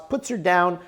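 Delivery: usual speaking voice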